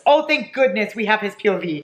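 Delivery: normal talking voice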